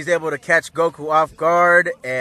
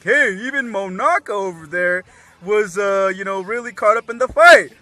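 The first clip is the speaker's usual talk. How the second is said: higher, louder, rising to excited; lightly voiced Yamcha; 5 semitones higher, 7 dB louder than usual. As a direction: blurt it out eagerly. Yamcha blurts the secret out.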